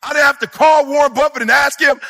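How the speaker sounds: normal talking voice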